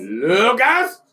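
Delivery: high loud rising call. Real, not acted.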